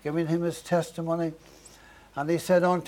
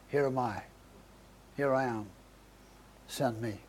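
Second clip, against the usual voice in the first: humble answer; 5 semitones lower, 10 dB quieter than usual. Retold or imitated. Isaiah's humble answer to God.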